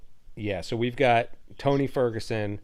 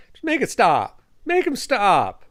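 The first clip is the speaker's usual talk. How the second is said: higher and louder, begging it to end; exasperated plea; 10 semitones higher, 7 dB louder than usual. Retold or imitated. Fan watching a one-sided fight pleads loudly for mercy. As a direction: cry out for the beating to stop.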